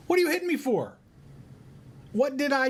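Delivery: high, squeaky donkey voice, indignant; talking donkey. Acted. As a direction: play the wronged donkey, high and pleading. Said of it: "the donkey turns around and goes".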